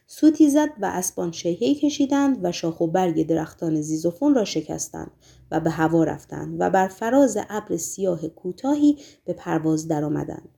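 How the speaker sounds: ordinary delivery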